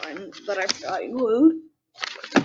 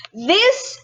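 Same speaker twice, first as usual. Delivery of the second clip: louder, higher delighted exclamation; excited; 5 semitones higher, 4 dB louder than usual. Real, not acted. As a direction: gush over the picture. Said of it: "best illustration in the entire book".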